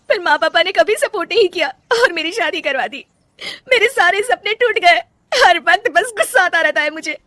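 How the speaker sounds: high, loud sobbing words; wife breaking down in tears before her family; crying. Acted. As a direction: speak through tears. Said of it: "Rajni फूट फूट कर रोने लगती है".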